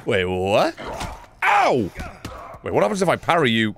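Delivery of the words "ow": sudden high "ow"; pained yelp. Real, not acted. Gamer hit by a zombie, yelping.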